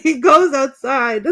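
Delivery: high, loud, giggly telling; excited retelling. Real, not acted. Fan retelling a chaotic movie scene, excitedly.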